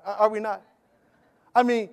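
ordinary talk, nothing marked

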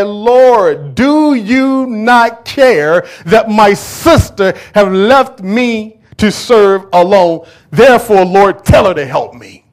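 loud, slow, high complaining plea; angry Martha. Acted. Martha complaining to Jesus, loud and aggrieved. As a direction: play Martha demanding the Lord's help. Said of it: "Now, she's angry".

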